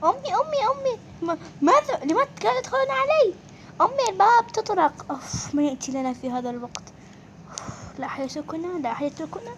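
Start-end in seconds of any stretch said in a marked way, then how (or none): 0.0-5.1 high calls for mum; urgent child's call, much higher. Acted. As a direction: call out to mum from the other room. Kids calling mother at door.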